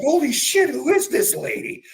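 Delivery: high, loud astonished; amazed. Retold or imitated. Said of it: "I'm like".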